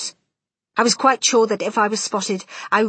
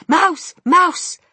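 shouts; 10 semitones higher, 8 dB louder than usual. A narrator's reading. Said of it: "no shouts of".

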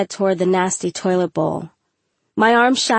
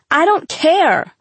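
high, slow, emphatic "I don't care"; "I don't care" pitched up and stretched out; firm dismissal; 9 semitones higher, 4 dB louder, slower than usual. A narrator's reading. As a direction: draw out "I don't care" to shut down the excuse. Teacher rejects student's excuse.

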